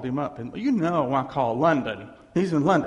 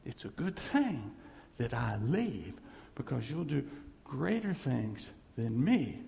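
soft preaching; 4 semitones lower, 7 dB quieter than usual. Real, not acted.

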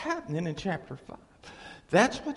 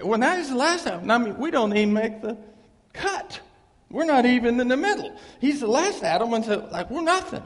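preaching at full force; 5 semitones higher, 7 dB louder than usual. Real, not acted. Preaching climax.

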